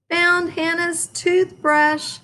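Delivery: high slow called-out find; yell. A narrator's reading. Froggy yelling his find to everyone. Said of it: "yelled Emma".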